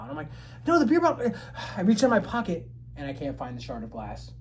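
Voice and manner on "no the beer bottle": high, loud protest; frantic insisting. Retold or imitated. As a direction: cut in high, desperate to be believed. Insists to disbelieving friend.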